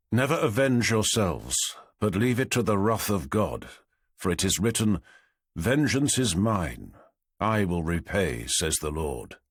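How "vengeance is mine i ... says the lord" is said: solemn scripture reading. A narrator's reading. Scripture quotation in epigraph.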